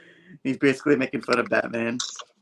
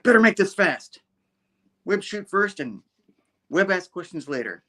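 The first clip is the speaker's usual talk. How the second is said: hero quip; 7 semitones higher, 3 dB louder than usual. Retold or imitated. Spider-Man quip in comic retelling.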